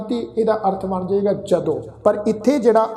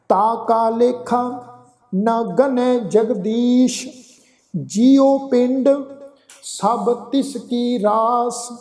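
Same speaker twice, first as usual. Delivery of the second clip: slow, measured Gurbani reading; scripture recitation; 2 semitones higher, much slower than usual. Shown as a demonstration. Teacher recites verse for congregation, slow sustained recitation. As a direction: draw out the line endings, pausing between phrases.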